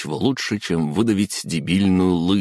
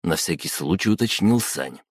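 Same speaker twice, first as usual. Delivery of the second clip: plain reading voice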